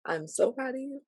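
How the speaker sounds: choked-up pride, almost crying; close to tears. Real, not acted. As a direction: voice lifting and softening, on the edge of crying. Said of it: "And I am about to cry".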